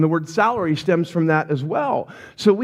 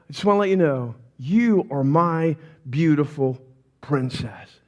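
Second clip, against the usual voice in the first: tender reassurance; 6 semitones lower than usual. Retold or imitated. Father reassuring his sad daughter.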